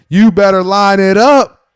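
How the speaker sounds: mock coach yell. Coach Tomlin yells at his players, loud and slow. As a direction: bark it like a fired-up coach. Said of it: "Mike Tomlin just coming out and setting the tone and saying".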